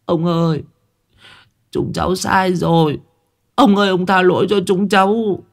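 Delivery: put-on high, tearful child begging, a touch of a sob; whimpering plea. A narrator's reading. Boys on the verge of tears plead with the old man, high and whimpering. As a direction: pout and whimper like a scared boy. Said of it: "bắt đầu mếu máo".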